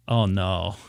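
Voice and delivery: low, sinking realization; understated horrified deadpan; retold dismay. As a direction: drop the voice in dread. Realizes he just ate horse.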